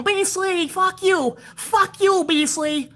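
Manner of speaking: put-on very high, childish whine; whiny kid troll. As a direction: whine it like a brat bragging to his mom. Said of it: "with your grubby Dorito paws and go".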